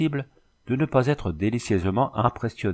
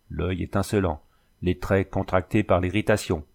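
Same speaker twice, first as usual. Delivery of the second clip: usual speaking voice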